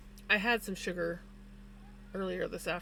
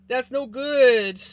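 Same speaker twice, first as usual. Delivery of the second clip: slow, much louder, drawn-out commiseration; slow sympathy; 3 semitones higher, 13 dB louder, much slower than usual. Real, not acted. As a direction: stretch it out to sound sorry for him. Sympathising with Retro's bad news.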